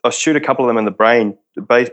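normal talking voice

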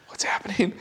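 imitated bewildered whisper; stunned whisper. Playing partner watching caddy scream at player, baffled whisper. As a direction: whisper it like a shocked bystander.

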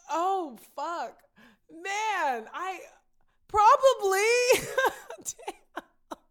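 high-pitched, laughing through real tears; breaking down. Real, not acted. Admitting parents probably cry alone, tearful and laughing. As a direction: give in to the answer with a drawn-out wail. Answers sad question while breaking down.

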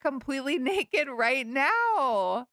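slow, drawn-out hammed-up desire; playful mock command to strip; mock lusty demand. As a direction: joke it like you're swooning. Retold own reaction, joking.

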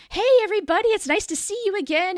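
cheery greeting. Acted. Voicing a crew reunion greeting.

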